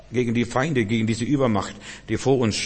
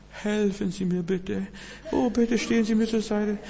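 zaghaftes Bitten, karikiert; 9 semitones higher, 6 dB quieter than usual. Acted. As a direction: beg feebly in a high put-on voice. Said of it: "Ich würde schon richtig schreien". (hohe, gespielt höfliche Bittstimme)

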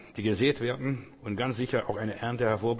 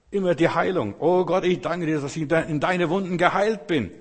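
raised, high-pitched exhortation; preaching prayer; 4 semitones higher, 5 dB louder than usual. Shown as a demonstration. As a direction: proclaim it fervently, pitched up and pressing on. (eindringlich predigendes Gebet mit erhobener Stimme)

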